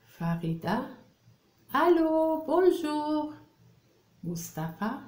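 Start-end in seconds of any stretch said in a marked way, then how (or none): 1.7-3.3 high-pitched, singing phone greeting; dialogue reading as caller. Acted. Teacher reading Farida answering the phone, high acted greeting. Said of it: "Je lis le dialogue".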